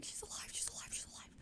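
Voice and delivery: hushed, repeated whisper; relieved whisper. Retold or imitated. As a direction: whisper it to yourself in disbelief. Retold relief at hearing cat breathe.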